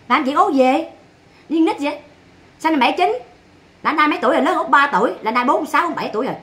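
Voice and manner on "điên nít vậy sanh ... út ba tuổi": normal talking voice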